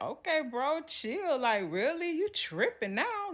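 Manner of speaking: high, amused brush-off; dismissive teasing. Retold or imitated. Daughter brushing off her serious dad, high and amused. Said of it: "And I'm like".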